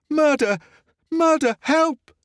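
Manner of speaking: high desperate screams; screaming for help. A narrator's reading. The lady rouses the parish, shrieking murder and help. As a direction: cry out with everything you have. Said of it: "she cried".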